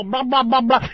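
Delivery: imitating empty chatter; punchy, repetitive mock babble; mocking yapping. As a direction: mimic someone yapping about nothing. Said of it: "talk about nothing and go".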